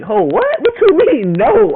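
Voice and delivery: high, loud disbelieving demand; outraged boyfriend, high and loud; indignant outburst. Retold or imitated. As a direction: fire it back at her, outraged. Girlfriend refuses to show phone.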